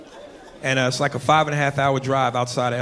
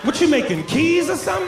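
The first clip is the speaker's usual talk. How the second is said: mock disbelief; 11 semitones higher than usual. Mocking the noise as keys.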